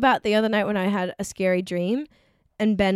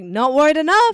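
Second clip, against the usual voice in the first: sarcastic; 7 semitones higher, 7 dB louder than usual. Retold or imitated. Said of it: "I was like".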